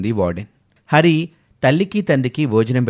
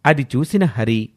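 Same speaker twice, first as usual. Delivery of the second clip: ordinary delivery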